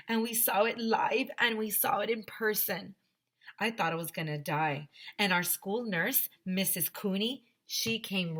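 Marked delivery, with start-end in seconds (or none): none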